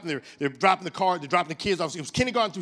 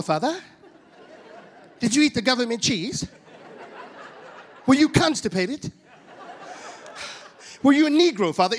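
lightly voiced curious kid, higher pitch; child's questioning; 4 semitones higher than usual. His children quiz father about being poor, lightly mock-innocent.

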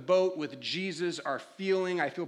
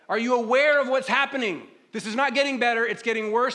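raised, louder acted protest; exasperated complaint; 4 semitones higher, 6 dB louder, faster than usual. Struggling believer pleading with sleeping God, exasperated. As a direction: press an urgent complaint on someone dozing.

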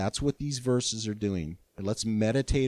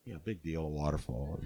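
bored grandpa; 8 semitones lower, 7 dB quieter than usual. Retold or imitated. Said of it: "and I'm like".

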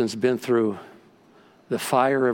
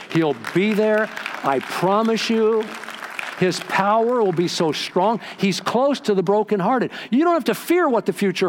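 impassioned preaching promise; 7 semitones higher than usual. Acted. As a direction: promise it with full conviction, stretching the words. Preaches a promise at high pitch.

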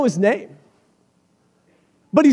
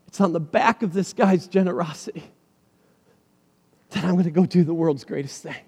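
softer, lower, calm reply; gentle Jesus; 6 semitones lower, 7 dB quieter than usual. Retold or imitated. Jesus explaining to disciples, quiet and gentle. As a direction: answer calmly and kindly.

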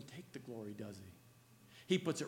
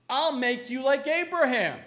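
loud, high proclamation of "Abraham"; thundering divine promise; 9 semitones higher, 11 dB louder than usual. Acted. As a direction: proclaim it like God himself. God's promise, loud climax.

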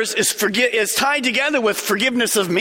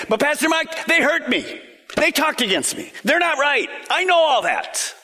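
higher pleading protest that climbs to a shout on "I know all that"; mock hurt church member; 6 semitones higher than usual. Pastor imitating a hurt member protesting to him, higher voice rising to a shout. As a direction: play the wounded member who won't let go.

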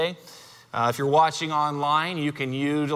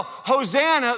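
crowd yell; 6 semitones higher than usual. Retold or imitated. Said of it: "When they yell".

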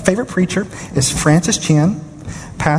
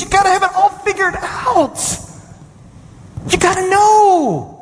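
high, exasperated retelling of his own protest; incredulous outburst. Retold or imitated. Preacher replaying his own scoffing reaction to the resignation, high and exasperated. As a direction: throw up your hands in mock disbelief. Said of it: "I'm listening to this and I go".

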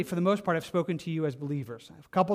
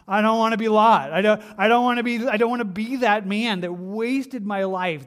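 much louder, pitched up, pleading cry; loud resolve; 4 semitones higher, 8 dB louder than usual. Real, not acted. Preacher crying out his vow to the congregation, loud. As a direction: cry it out with all your heart.

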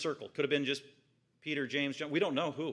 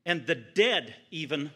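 proclaiming; 2 semitones higher, 4 dB louder than usual. Acted. Jesus sending word back to John, loud and triumphant. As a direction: announce each miracle like a herald.

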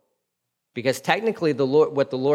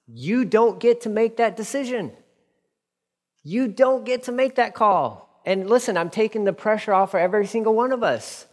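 higher, intense preaching voice; 7 semitones higher than usual. Acted. Pastor pressing the congregation, intense preaching. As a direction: drive the point home to the room.

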